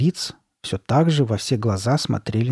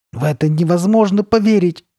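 high, loud cry of disbelief; exclaiming; 8 semitones higher, 6 dB louder than usual. A narrator's reading. Girl holding her distorted face, exclaiming in disbelief. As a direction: exclaim it, voice raised. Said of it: "воскликнула Карли Бет".

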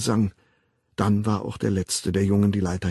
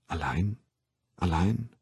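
much softer, wondering echo; quiet echo; 4 semitones lower, 7 dB quieter than usual. Acted. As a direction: murmur it back to yourself. Bernard echoes sadly.

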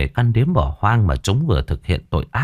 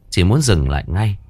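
plain reading voice

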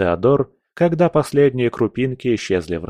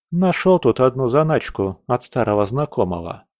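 ordinary delivery

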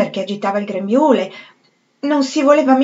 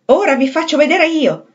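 higher, louder cry of defiance; shouted defiance; 6 semitones higher, 5 dB louder than usual. A narrator's reading. Septimus shouting at Holmes before he jumps. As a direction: fling it out at the doctor. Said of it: "gridò Septimus".

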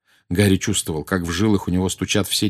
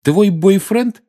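taunting; 12 semitones higher, 6 dB louder, much slower than usual. Acted. Harry taunting back.

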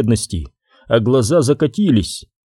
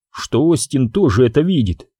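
usual speaking voice

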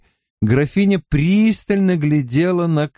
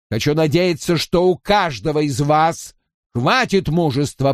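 raised, ringing courtly address; solemn noble lord; 3 semitones higher than usual. Acted. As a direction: proclaim it to the whole feast. Robert addresses the lords. (торжественный голос графа)

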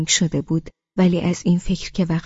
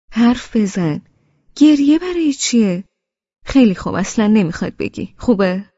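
put-on raised, troubled pleading with a crying girl; louder and higher, distressed then softening; upset; 4 semitones higher, 6 dB louder than usual. A narrator's reading. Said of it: "ناراحت گفت". (ناراحت و نگران، با صدای بلندتر)

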